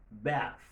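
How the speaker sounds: "bath" ending on a voiceless th; voiceless final th. Shown as a demonstration. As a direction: finish "bath" on air, without buzz. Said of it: "il vient non sonore". ("bath" ak th san vwa)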